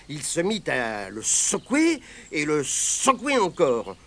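plain reading voice

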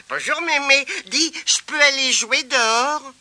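high, eager little voice; eager child. Acted. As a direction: ask hopefully to go play. Boy asks grandmother.

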